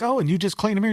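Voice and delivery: retelling the documentary maker asking the rabbit seller, mock innocent; mock interviewer. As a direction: play the naive filmmaker poking fun. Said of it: "when he's like".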